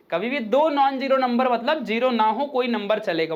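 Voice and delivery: normal talking voice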